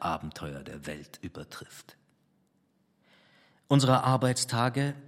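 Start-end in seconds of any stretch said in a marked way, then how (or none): 0.0-2.0 whisper. A narrator's reading. Narrator closing the thought, whispered. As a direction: drop to a breathy whisper at the end.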